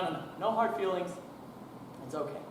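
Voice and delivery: ordinary delivery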